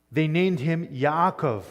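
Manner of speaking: "Yaakov" said slowly with a long double a, in Hebrew form; Hebrew name Yaakov. Shown as a demonstration. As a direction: pronounce "Yaakov" slowly, Hebrew style, as yah-ah-kov. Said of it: "which Jacob".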